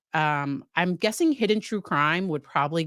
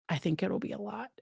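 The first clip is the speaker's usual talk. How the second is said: softer, quicker muttered repeat; quiet aside; 3 semitones higher, 8 dB quieter, faster than usual. Shown as a demonstration. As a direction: drop it under your breath, quickly. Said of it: "that's me trying to find nice words".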